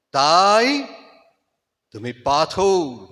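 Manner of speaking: dramatic Bengali recitation, rising to loud peaks and dropping to near silence; impassioned recitation with swelling climaxes and hushed falls; poem recitation. Acted. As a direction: declaim it like a stage tribute to a fallen hero.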